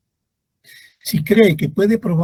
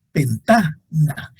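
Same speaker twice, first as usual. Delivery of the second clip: slow demo word; much slower than usual. Shown as a demonstration. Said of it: "Esa no se tilda".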